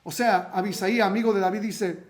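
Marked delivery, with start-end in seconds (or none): none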